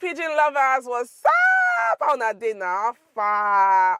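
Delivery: loud, high-pitched hype opener; excited greeting. Real, not acted. Teacher greets pidgin lovers at video start, excited shout.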